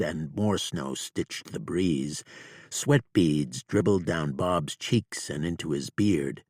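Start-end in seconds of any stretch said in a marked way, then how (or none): none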